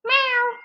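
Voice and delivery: high mewing reply to the cat; answering meow. Acted. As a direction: mew back at the cat playfully. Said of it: "The tailor replied".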